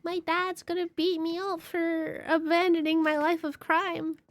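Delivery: high-pitched, worried character voice; game character dreading a beating. Acted. Group member about to leave, high-pitched dread.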